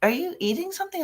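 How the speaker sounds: high, suspicious coworker voice. Retold or imitated. Coworker catching her boss eating cookies, high and suspicious. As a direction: pitch up as the nosy coworker.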